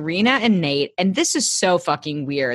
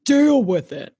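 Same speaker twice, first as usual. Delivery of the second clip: dismissive; 5 semitones higher, 3 dB louder than usual. Real, not acted. Dismissive to character.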